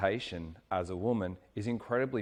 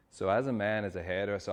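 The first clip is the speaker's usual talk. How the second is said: ordinary talk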